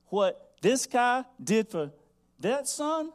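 rising to a pulpit cry; preaching at full force. Acted. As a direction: drive the sermon up and up.

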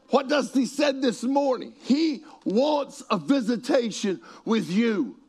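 high-pitched, driving preacher's delivery; full-force preaching. Real, not acted. Preacher telling church Jesus is in the now, full-force preaching. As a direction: proclaim it with each key word punched.

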